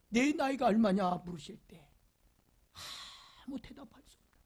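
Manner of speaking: ordinary delivery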